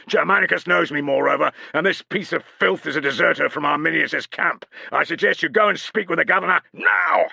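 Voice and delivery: full-volume dressing-down; parade ground volume. A narrator's reading. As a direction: roar it across the parade ground. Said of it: "used his full parade ground volume".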